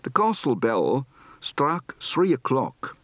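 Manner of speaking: moderate Dutch accent, slow and careful; measured Dutch-accented English; medium Dutch accent. Shown as a demonstration. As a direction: give "castle" a Dutch open a, medium Dutch accent. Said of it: "Medium accent".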